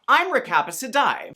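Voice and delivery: normal talking voice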